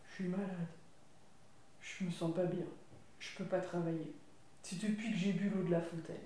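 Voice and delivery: sick dog. Acted. Sick dog telling Tom why he can't work. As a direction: sound ill and weak.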